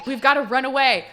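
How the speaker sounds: high, loud, rushed announcement; acted urgent outburst. Bride calling off the ruined ceremony, high urgent cry. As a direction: call a halt in mock panic.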